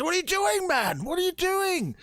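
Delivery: high-pitched panicked protest; acted alarm. Doctor to a man charging off with fire, alarmed. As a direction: cry out in alarm, pitch shooting way up. Doctor sees visitor grab torch.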